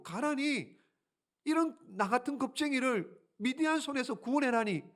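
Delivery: can't believe what he hears; incredulous Gideon. Acted. Gideon's disbelief.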